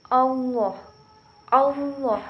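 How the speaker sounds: "Allah" with a thick, rounded "au" sound, like "Auloh"; teacher shows the thickened lam in "Allah"; heavy lam. Shown as a demonstration. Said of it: "Mengucapkannya bukan al, akan tetapi au".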